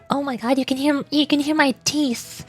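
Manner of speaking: normal talking voice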